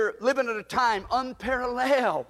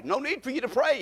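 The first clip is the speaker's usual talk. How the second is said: ordinary delivery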